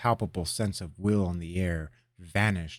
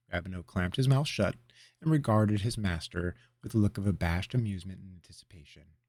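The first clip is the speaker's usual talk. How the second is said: usual speaking voice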